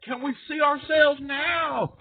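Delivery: high, slow shouted call from above; hammed-up shout; mock shout. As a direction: bellow it down from the heavens. Mock heavenly voices calling down.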